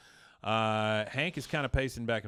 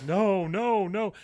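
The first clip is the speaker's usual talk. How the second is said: high, loud mock exasperation; acted-out pleading frustration; frustrated retold refusal; 8 semitones higher, 6 dB louder, much slower than usual. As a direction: imitate a man at his wits' end. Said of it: "He's so frustrated at this point".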